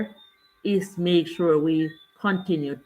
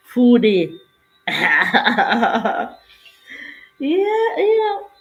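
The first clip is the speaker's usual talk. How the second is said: loud call then a giggle; laughing greeting; 4 semitones higher, 5 dB louder, much slower than usual. Real, not acted. Host laughs warmly as a favourite viewer appears. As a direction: laugh with delight at seeing a friend.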